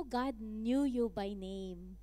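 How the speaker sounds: normal talking voice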